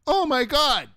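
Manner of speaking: high, loud imitated freak-out. Monster truck guy seeing his brakes, freaking out. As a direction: gush in a hammed-up, high-pitched shout. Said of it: "He's freaking out".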